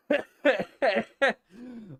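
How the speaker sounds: loud wheezing laugh; high laugh. Real, not acted. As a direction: crack up again. Laughing at the email.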